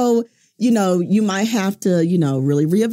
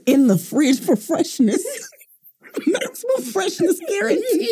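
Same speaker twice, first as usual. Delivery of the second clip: laughing; 13 semitones higher than usual. Real, not acted. Laughing at Newports joke.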